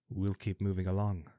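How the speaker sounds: plain reading voice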